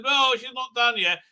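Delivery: slightly hammed-up high objection; mock protest. Objection in retold trial scene.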